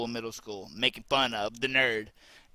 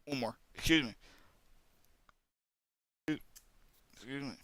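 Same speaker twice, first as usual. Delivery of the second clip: hiccuping between words; hiccups; 5 semitones lower, faster than usual. Real, not acted. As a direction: talk through the hiccups. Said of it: "now I'm hiccuping".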